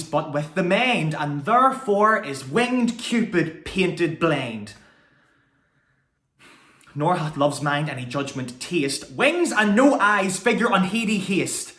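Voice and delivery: high, ringing stage verse, building in force; impassioned Shakespeare monologue. Acted. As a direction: declaim it with rising fervour, pitch soaring on key words.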